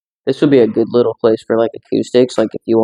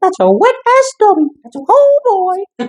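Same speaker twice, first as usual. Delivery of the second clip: very high squeaky cartoon voice, stretched out; squeaky mock voice; slower than usual. Friend mocking the wet stubby, squeaky cartoon voice.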